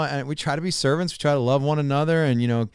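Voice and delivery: ordinary delivery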